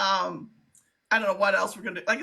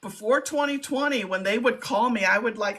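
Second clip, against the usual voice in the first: ordinary talk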